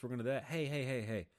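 usual speaking voice

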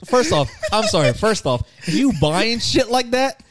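high, emphatic outburst slowing at the end; outraged disbelief. Real, not acted. Co-host condemning people who buy bottled farts, outraged. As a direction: protest loudly, dragging out the verdict.

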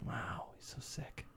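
slow bedside whisper; very soft, drawn-out murmur; hushed awe. Acted. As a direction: whisper it as if by a sickbed. Hushed bystander at acted sickbed.

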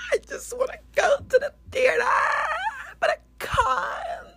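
high, loud hammed-up sobbing; mock crying influencer. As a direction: fake wailing about first world problems.

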